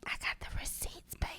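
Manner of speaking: hushed, whispery little boast; playful whisper. Pretend. Wife bragging she kept the old emails, whispering playfully. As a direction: drop to a teasing whisper.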